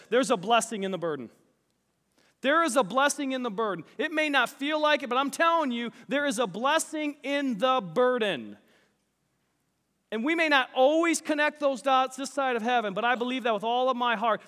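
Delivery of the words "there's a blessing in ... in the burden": earnest sermon refrain; preaching. Real, not acted. Preacher pressing the blessing in the burden on the church, fervent. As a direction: drive the message home to the church.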